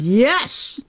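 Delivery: emphatic, drawn-out retold yes; eager yes. Aviator retelling her answer to the astronaut invitation, eager. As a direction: jump at the offer.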